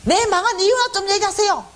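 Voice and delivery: high-pitched, indignant demand; railing at God. Retold or imitated. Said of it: "이제 난리가 난 거죠". (난리 치며 높은 목소리로 따지듯)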